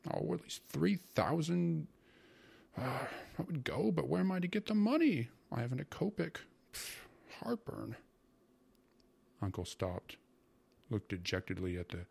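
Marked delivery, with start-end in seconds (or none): 0.1-6.4 high-pitched, exasperated moan; complaining uncle. A narrator's reading. Uncle bemoans to his sister having no money, exasperated. 6.7-8.0 slow, whispered groan of discomfort; pained puff. A narrator's reading. Uncle suffering heartburn as he complains, whispery pained aside.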